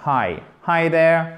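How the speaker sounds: "Hi, hi there" said slowly in English; drawn-out friendly "Hi, hi there"; English greeting example. Shown as a demonstration. Teacher shows students a simple English greeting, slowly. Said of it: "vous connaissez ça".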